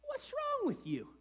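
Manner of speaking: high, mock-outraged parent squeal; hammed-up indignant parent; exasperated parent. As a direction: squeak it out like a baffled dad whose kids hate the gift. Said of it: "I would be like".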